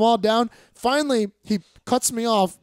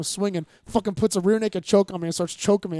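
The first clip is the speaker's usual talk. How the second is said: normal talking voice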